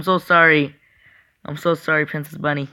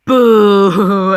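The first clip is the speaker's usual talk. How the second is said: mock scare shout; 4 semitones higher, 9 dB louder than usual. Gamer spooking the in-game princess.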